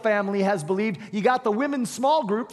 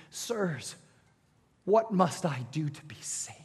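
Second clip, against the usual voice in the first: hushed plea; 4 semitones lower, 6 dB quieter than usual. Acted. Terrified jailer falling before Paul.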